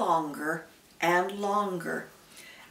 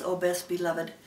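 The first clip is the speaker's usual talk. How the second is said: ordinary delivery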